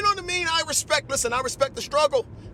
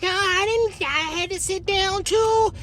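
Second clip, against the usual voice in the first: Rosa Parks voice, higher and slow, scolding; slow scolding old lady, higher pitched; 4 semitones higher, slower than usual. Retold or imitated. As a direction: play a stern old woman dressing him down. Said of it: "She said".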